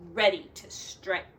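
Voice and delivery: plain reading voice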